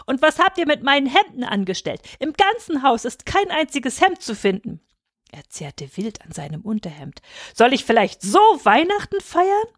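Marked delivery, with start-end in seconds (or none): 0.0-4.8 high, indignant scolding; father ranting at the children; mounting angry rant. A narrator's reading. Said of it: "Seine Stimme wurde immer lauter". 7.5-9.7 high, outraged question; raging. A narrator's reading. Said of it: "Fatih tobte wirklich".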